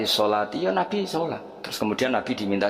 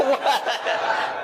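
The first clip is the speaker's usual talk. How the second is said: high breathy laugh falling away; a falling fit of laughter; 12 semitones higher than usual. Real, not acted. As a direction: crack up mid-sentence. Preacher laughing at own joke.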